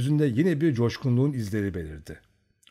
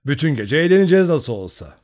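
louder, higher merry shout; jolly promise; 5 semitones higher, 9 dB louder than usual. A narrator's reading. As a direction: promise a night of fun. Cheerful parting words. (kızlara neşeyle, yüksek sesle)

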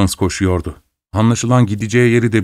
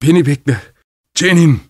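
raised voice, pleading to wait; mildly frightened shout rising higher; fearful calling; 4 semitones higher than usual. A narrator's reading. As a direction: call after them in alarm. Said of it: "diye korkuyla bağırdı".

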